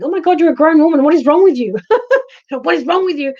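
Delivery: high, loud, fast self-scolding; mock telling-off of a grown woman; scolding herself. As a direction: tell yourself off, exasperated and half laughing. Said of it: "and I'll think".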